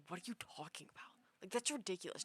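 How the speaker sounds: quick unvoiced whisper, scoffing; whispered offended retort. Offended dream people scoffing at her question, fast whisper. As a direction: hiss it like an offended dream person.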